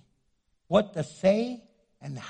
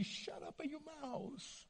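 teasing whisper breaking into a laugh; mock scolding, whispered; playful whisper; 8 semitones higher, 20 dB quieter, slower than usual. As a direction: hush someone jokingly, laughing. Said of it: "but I say".

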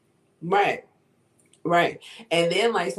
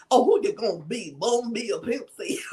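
mock country accent; 2 semitones higher than usual. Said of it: "freestyling with jokes in a country ass accent".

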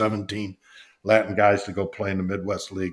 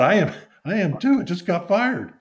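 much higher-pitched retold protest; indignant retort; 10 semitones higher than usual. Freshly fired employee answering his boss, mock indignant.

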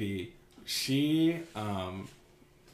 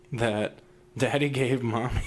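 usual speaking voice

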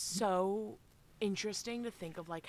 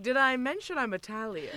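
laughing mock; 6 semitones higher, 9 dB louder than usual. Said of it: "He's like".

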